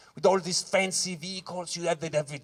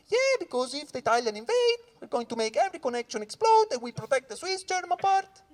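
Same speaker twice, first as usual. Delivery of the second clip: higher, smug captain explaining; captain's voice; 8 semitones higher than usual. Retold or imitated. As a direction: play the captain, high and offhand. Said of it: "he was like".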